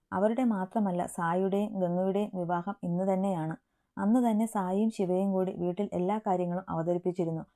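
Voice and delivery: ordinary delivery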